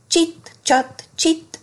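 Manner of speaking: high-pitched, mildly snappy scissors; indignant snap. A narrator's reading. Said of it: "csattant fel az olló". (enyhén csattanó, magasabb hang)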